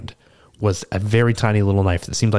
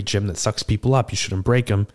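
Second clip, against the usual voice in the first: usual speaking voice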